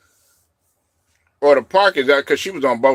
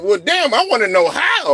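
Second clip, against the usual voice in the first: indignant; 8 semitones higher than usual. Retold or imitated. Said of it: "I'm like".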